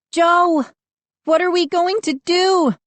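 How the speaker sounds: very high, alarmed cry for help; frightened. A narrator's reading. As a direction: plead in a high, scared voice. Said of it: "I heard Mindy's frightened voice".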